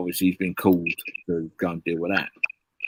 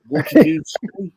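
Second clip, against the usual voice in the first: much higher, loud, slow outraged demand; indignant cop; 8 dB louder than usual. Retold or imitated. The cop, stunned at being called a nonce, in a loud high voice. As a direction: bristle at the insult as the copper.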